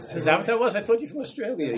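high, loud teasing through laughter; laughing banter. Real, not acted. Rabbi teasing a student about the English accent, amused and laughing. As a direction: joke back while the laughter rolls.

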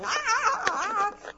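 high, loud frantic cries; frazzled yelp. Retold or imitated. Stressed parent driven crazy by kids, frantic yelps. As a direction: yelp like a parent at the end of their rope. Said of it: "They're making you a meshuggah".

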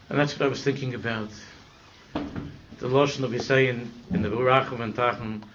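ordinary delivery

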